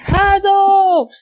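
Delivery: very high, loud drawn-out call. Acted. Reader acting out a desperate call to Hado. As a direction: cry the name out dramatically. Acted cry of a name.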